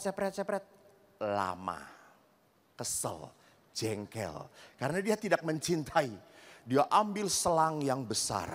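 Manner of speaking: normal talking voice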